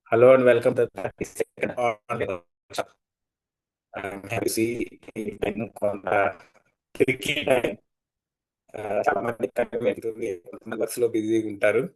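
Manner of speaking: choppy, breaking-up audio. Real, not acted. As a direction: voice cutting out over a bad line. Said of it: "మీ voice break అవుతుంది sir".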